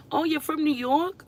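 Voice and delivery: high, rising mock asker; imitating a confused stranger, voice jumping up; mock question. People confusing Rhode Island with New York, mock high question. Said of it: "people confuse us".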